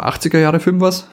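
ordinary talk, nothing marked

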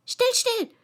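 high, urgent shushing; hurried hushing. A narrator's reading. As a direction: hush her quickly in a high voice. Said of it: "in einem leisen, schnellen Tone".

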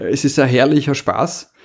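ordinary delivery

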